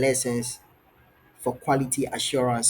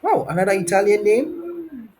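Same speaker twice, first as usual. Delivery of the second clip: amazed; 8 semitones higher than usual. Real, not acted. Tutor hearing another foreign dish, high amazed surprise. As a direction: exclaim high, stretching the end.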